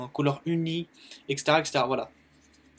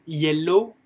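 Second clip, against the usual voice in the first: "yellow" loud with a rising end; teacher's clear model; 6 dB louder than usual. Shown as a demonstration. A teacher models the word yellow loudly for learners. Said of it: "qui va vous faire après yellow".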